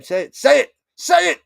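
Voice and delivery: begging the game to say the name; excited urging. Real, not acted.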